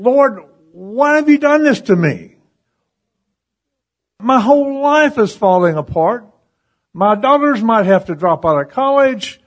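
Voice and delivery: high-pitched, mock anguished plea; retold crying out. Man pouring his heart out to the Lord, retold anguished cry. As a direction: plead upward as if crying out, without real tears. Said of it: "I was yelling and crying and saying".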